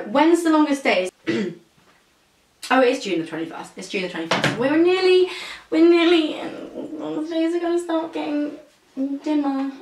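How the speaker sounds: normal talking voice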